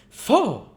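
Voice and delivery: loud high scornful snort; beadle's scornful faugh; disgusted faugh. Acted. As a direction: spit out disgust. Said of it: "in strong indignation".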